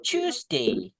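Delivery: "Tuesday" high and stressed as "chusde"; emphasised pronunciation. Shown as a demonstration. Teacher exaggerating "Tuesday" to the class, high stressed. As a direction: punch "Tuesday" high, with a "ch" sound. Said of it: "Kayak ada penekanannya".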